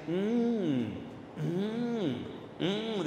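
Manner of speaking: long, rising-and-falling "mm"; imitated humming "mm". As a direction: imitate a listener's drawn-out hum. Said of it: "una persona que me decía". (imitando un "mm" largo)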